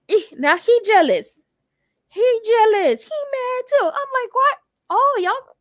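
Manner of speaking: high-pitched, rising gossip reaction; scandalized excitement. Real, not acted. Host reacting to a lover's jealousy, high scandalized excitement.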